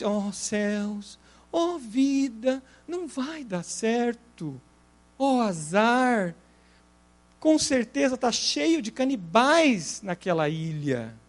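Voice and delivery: slow, soft, mournful lament; gloomy Hard. Retold or imitated. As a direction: drag it out like a hopeless hyena. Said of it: "Aí o Hard".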